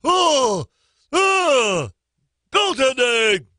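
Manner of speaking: mock fans. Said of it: "they're like".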